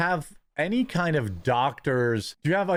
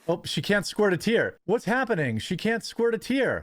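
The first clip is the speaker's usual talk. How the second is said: mocking taunt; 7 semitones higher than usual. Host taunting the guest who can't cry, mocking. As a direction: pitch climbing higher with each mocking line.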